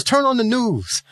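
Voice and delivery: high, slow mock coworker on the phone; lightly acted coworker barking an order; imitating yelling coworker. Coworker on the phone tells him to check the news, mock yell. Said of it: "he's yelling on the phone at me".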